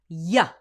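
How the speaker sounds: "Y" said crisply as the letter. Shown as a demonstration. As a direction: snap out the letter Y. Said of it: "say the letter by itself".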